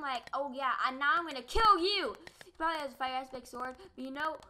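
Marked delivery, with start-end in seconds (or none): 0.0-2.1 loud, raised taunt building to a yell; heated threat. Real, not acted. Gamer threatening a kill stealer, heated shout. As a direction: punch the threat out loudly.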